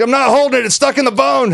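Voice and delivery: high, loud whining protest; whiny protest. Retold or imitated. Imitating the stabbed man's high, loud protest. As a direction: wail it like the hurt guy. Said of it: "He's like".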